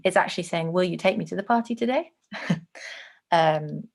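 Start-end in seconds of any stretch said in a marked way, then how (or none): 0.7-2.1 "today" rising at the end as a request; questioning. Acted. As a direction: ask hopefully, lifting the tune on "today". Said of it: "It's more of a question".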